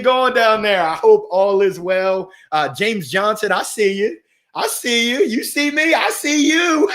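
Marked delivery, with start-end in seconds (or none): 4.5-6.9 higher, rising sing-song greeting; tickled shout-out breaking into laughter; playful excited. Real, not acted. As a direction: build to a high, gleeful call.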